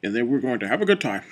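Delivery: playful mock-presidential voice with lifted phrase endings; Barack Obama. Shown as a demonstration. Reviewer playfully imitating the president inviting Michelle on a date, Obama impression. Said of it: "we all do impression of Barack Obama".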